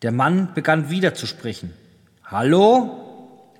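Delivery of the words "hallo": raised, questioning call. A narrator's reading. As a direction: call out higher to find someone. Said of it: "rief er". (rufend, fragend gehoben)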